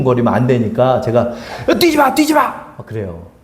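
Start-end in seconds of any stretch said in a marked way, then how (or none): 1.7-2.4 raised, sharp call to stop running; father yelling at kids not to run; scolding shout. Retold or imitated. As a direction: yell at the kids to stop jumping.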